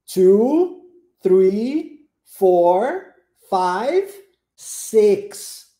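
slow "two, three, four, five, six" counted aloud; counting model. Shown as a demonstration. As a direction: count out slowly for repeating. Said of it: "nós contamos one".

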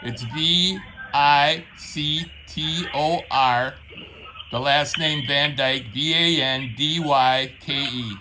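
over-clear spelling for a slow listener; deliberate spelling. Acted. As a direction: spell it out letter by letter, mock-patient. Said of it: "spell your name, like, slowly and loudly".

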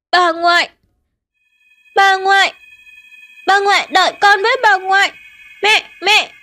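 put-on a frightened kid pointing and wailing; crying little boy. Little boy calling to his ghost grandmother, crying. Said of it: "mếu máo khóc".

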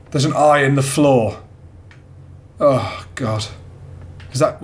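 ordinary talk, nothing marked

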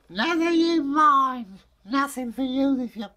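a wife refusing dinner; snappy mother. Acted. Mother snaps at dad asking about dinner.

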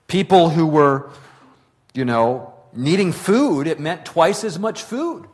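rising fervour of a sermon; impassioned preaching. Acted. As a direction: drive home the privileges and the stakes. Preaching builds to full force.

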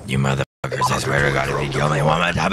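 low seething threat; raging gamer. Real, not acted. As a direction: growl it low, barely holding. Raging after repeated deaths.

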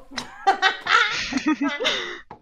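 gloating laugh. Real, not acted. Player laughing at a teammate's bad luck, gloating laugh.

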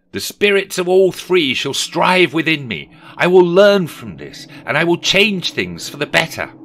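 loud, high dramatic pledge; fervent vow. Acted. Scrooge promising the spirit he will learn, fervent. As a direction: swear it with full conviction.

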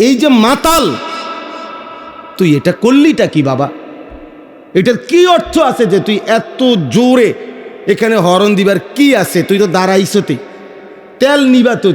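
preacher's heated, incredulous rebuke; indignant scolding. Retold or imitated. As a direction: scold a reckless youngster in exasperation. Scolding the horn-honking youths.

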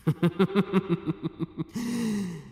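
high, drawn-out villain's laugh falling away; a long gleeful cackle that sinks at the end; villainous laugh. Acted. Villain departing from the hero, laughing high and long.

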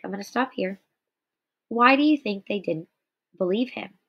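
ordinary talk

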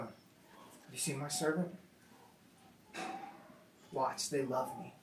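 low and soft, proud; quietly proud, almost tender; hushed Jesus voice. Retold or imitated. Jesus showing his servant to the court, hushed.

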